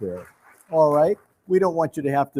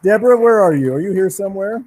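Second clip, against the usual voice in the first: loud, higher call across the audience; 6 semitones higher, 10 dB louder than usual. Real, not acted. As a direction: call her name out to the room. Calling a second winner forward.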